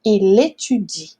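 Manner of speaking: "Il étudie" joined up, L running into É; liaison as one word. Shown as a demonstration. Teacher shows students the liaison, slow joined-up word. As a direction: slowly link "Il étudie" as a single word, i-lé-tu-di. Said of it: "vai falar uma palavra só".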